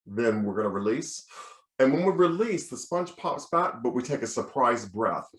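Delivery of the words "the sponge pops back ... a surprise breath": ordinary talk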